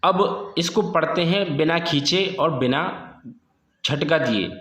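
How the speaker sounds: usual speaking voice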